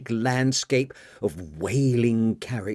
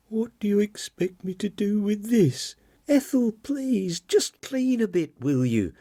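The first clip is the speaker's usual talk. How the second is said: much higher, flustered woman voice; 11 semitones higher than usual. Acted. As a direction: sound rattled and pushed. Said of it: "said a flustered Marian".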